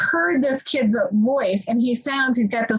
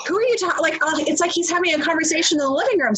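usual speaking voice

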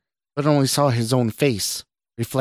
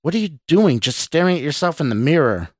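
higher, heated questioning; angry; 6 semitones higher, 4 dB louder than usual. A narrator's reading. Boss berates Scott for staring at mirror, angry. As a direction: demand it in exasperated anger. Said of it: "Mark asked angrily".

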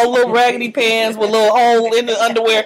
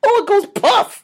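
imitated date; 10 semitones higher, 6 dB louder than usual. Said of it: "He said".

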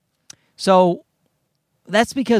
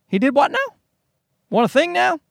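high, bewildered double take; baffled surprise; 8 semitones higher than usual. Retold or imitated. Brother hearing he won a contest, high baffled surprise. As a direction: play it as totally confused, pitch climbing. Brother's confused reply quoted.

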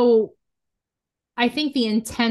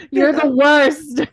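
loud, drawn-out exasperated jab; slow, higher and loud, half laughing; mock outrage; 5 semitones higher, 7 dB louder, much slower than usual. Host telling off Zach for fun, mock outrage. Mock outburst at disliked show character.

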